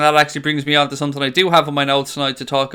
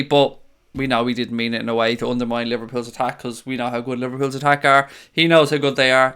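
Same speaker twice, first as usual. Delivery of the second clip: ordinary delivery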